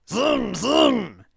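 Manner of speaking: high, loud creature noise; put-on incoherent character grumble; incoherent grumbling. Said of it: "he grumbled incoherently".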